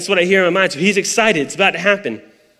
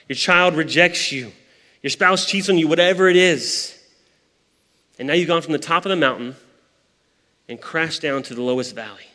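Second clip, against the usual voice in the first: usual speaking voice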